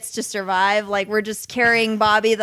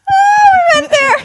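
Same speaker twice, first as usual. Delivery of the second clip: laughing; 12 dB louder than usual. Real, not acted. Laughing fit after dark joke.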